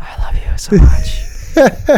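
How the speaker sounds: slow breathy whisper, hammed up; mock tender whisper. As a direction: whisper it like a sappy husband.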